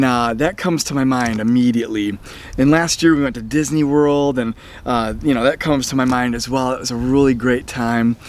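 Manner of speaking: ordinary talk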